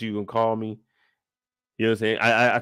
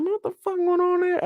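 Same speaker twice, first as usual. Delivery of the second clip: bewildered. Retold or imitated. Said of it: "I'm like".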